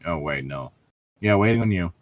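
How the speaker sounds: normal talking voice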